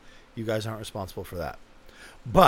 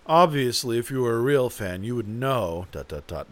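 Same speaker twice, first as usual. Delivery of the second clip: mock snooty fan, loud opening then falling away; superior condescension; 5 dB louder than usual. Superior fan talking down to others, mock condescension. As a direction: imitate a condescending know-it-all. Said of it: "none of that superior, uh, condescension".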